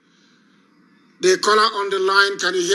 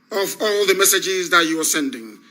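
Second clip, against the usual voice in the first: usual speaking voice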